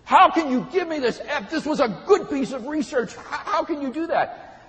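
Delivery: loud, high indignant outburst; angry student. Retold or imitated. Student storms into professor's office over an F, angry. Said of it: "came into his office angry and said".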